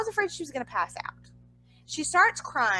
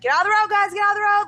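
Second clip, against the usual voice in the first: higher and louder, imitating a guard; mock shout clearing the crowd; security yelling; 8 semitones higher, 6 dB louder, much faster than usual. Security telling fans to leave the road, mock shout. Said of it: "they're like".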